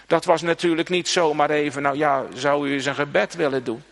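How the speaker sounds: normal talking voice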